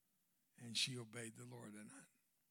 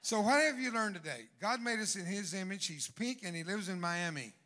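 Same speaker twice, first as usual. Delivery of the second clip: loud, high triumphant punchline; punchline shout; 5 semitones higher, 10 dB louder than usual. Real, not acted. Preacher hammering the joke's punchline to congregation, loud. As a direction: belt the joke's payoff to the crowd. Punchline delivered to laughing crowd.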